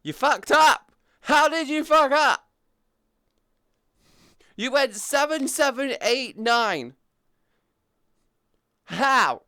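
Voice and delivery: high, incredulous shouting; loud outburst. Real, not acted. Outburst at someone's mistake.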